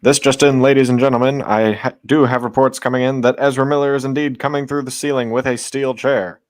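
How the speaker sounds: loud booming news anchor; newscaster voice. Pretend. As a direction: announce it like breaking news. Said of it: "but in a newscaster voice".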